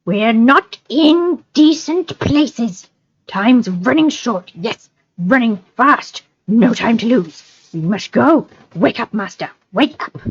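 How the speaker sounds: high, slow, hissing creature voice; urgent Gollum. Acted. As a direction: drag them on like Gollum. Gollum urging hobbits.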